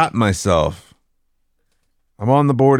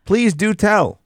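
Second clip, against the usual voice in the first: mock eager; 11 semitones higher than usual.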